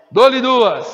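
loud auctioneer call. Real, not acted. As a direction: ring out the going-twice call. Auctioneer's going-twice call. (chamada alta de leiloeiro)

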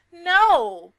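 sudden loud high no; shocked loud outcry. Real, not acted. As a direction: blurt out a shocked no. Shocked outburst at child's risk.

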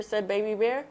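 ordinary delivery

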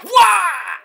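high, drawn-out "waah" shout; imitated crowd cheer. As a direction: mimic fans roaring in amazement. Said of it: "Fans were like".

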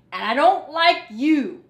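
nasty rat snubbing Raccoon; rude retort. Acted. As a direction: bite it off rudely. Rat rudely rebuffs Raccoon.

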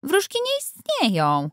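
put-on boy arguing with the adults about fairies; boy protesting. A narrator's reading. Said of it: "zaprotestował Louis".

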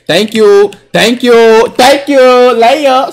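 celebratory shout. Real, not acted. Streamer celebrating a layup, loud excited thank-yous.

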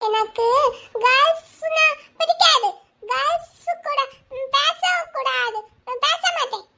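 put-on high, slow little-boy voice; stubborn small boy. Small boy refusing to talk to girls, stubborn child voice.

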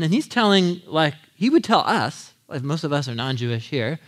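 usual speaking voice

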